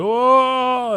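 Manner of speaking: high, loud mock wail; long hammed-up sobbing "oh"; retold crying. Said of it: "And Brook is crying".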